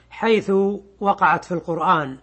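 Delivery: ordinary talk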